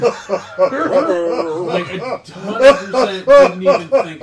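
talking through loud laughter; slow, high, laughing hard. Real, not acted. Laughing at own story.

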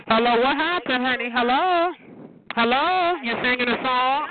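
high-pitched, insistent hello; mocking call-out. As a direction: call out high for someone who's not answering. Calling to unresponsive person on phone.

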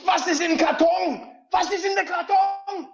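high, loud, anguished German shout; German Brad Pitt. Acted. Doing the requested line for friends, high anguished German shout. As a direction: scream the line like the desperate movie scene. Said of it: "in German, but in Brad Pitt accent".

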